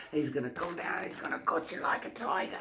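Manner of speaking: soft, hushed British celebrity impression; Russell Brand. Host showing off his impression, hushed Russell Brand. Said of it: "That's Russell".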